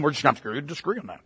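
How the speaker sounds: a mild mock of a dismissive shrug; dismissive mock quote. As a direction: rattle it off like someone ducking the truth. Mocking a dismissive person.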